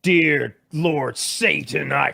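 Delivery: slow, solemn mock incantation; laying a curse. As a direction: intone it like a dark prayer. Mock prayer to Satan.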